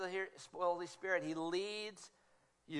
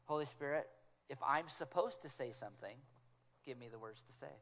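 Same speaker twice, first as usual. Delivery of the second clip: quiet prayer; 5 semitones lower, 6 dB quieter than usual. Pretend. A sent-out prayer.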